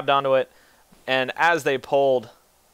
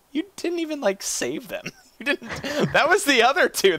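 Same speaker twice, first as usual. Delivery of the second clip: high, breathy laughing taunt; 12 semitones higher than usual. Real, not acted. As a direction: tease through laughter. GM laughing at player.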